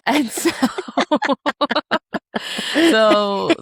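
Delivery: high, giggly words breaking into laughter; real laughing. Real, not acted. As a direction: try to carry on through the giggles. Laughing at husband's Motel Six line.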